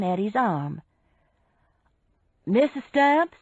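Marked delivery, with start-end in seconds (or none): 2.4-3.4 raised, loud official hail; calling out. A narrator's reading. Major summoning a suspect from the boat, calling out. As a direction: call her by name, pitched up. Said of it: "Major Bissell called".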